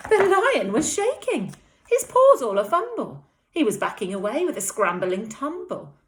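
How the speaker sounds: put-on lively read-aloud to young children; animated storytime voice; story narration. A narrator's reading. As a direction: read it like a bedtime story for little ones. Teacher reads picture book aloud.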